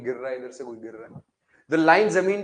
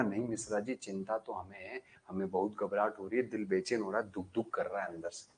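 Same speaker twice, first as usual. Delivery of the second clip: low, soft, fearful whimper of a voice; frightened insulator; 7 semitones lower, 9 dB quieter than usual. Acted. Insulator begs Mishra ji, low and soft with fear. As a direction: plead quietly, scared and sinking. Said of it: "फिर भी वो insulator बोला".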